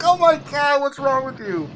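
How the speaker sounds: high, laughing exasperation; mock exasperation. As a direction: pitch it up in disbelief. Retold own exclamation.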